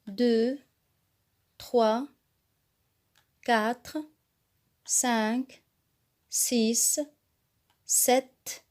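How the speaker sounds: each number said slowly and clearly for the class to repeat; counting aloud for repetition. Shown as a demonstration. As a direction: count slowly so pupils can echo. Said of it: "vous répétez après moi". (compter lentement et clairement pour que la classe répète)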